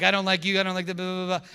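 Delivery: high, loud mocking complaint; hammed-up angry rant; mock hostile. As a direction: rant it like the haters. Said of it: "They're like".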